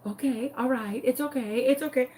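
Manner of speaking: soft, hushed reassurance; trying to be calming. Retold or imitated. Grace comforting the upset chicken owner, calming. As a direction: soothe an upset caller softly. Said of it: "she's totally trying to be calming".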